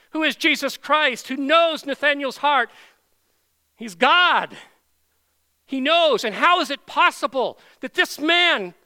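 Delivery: loud, high, impassioned pulpit proclamation; full-force preaching. Real, not acted. Preacher declaring Christ knows the heart, at full force. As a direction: thunder it out to the congregation.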